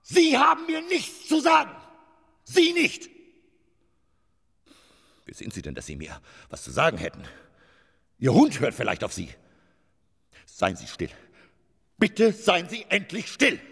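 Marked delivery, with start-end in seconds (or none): 0.0-3.1 very high, loud furious yell; furious shout. Acted. Actor screaming that the stagehand cannot order him, furious. 8.2-13.6 loud, high angry demand for silence; angry outburst. Acted. Actor furiously telling the stagehand to be silent.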